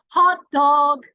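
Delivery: loud, high call across a yard; calling a dog. Shown as a demonstration. Teacher showing kids how to call the dog, loud high call. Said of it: "how do you call the dog".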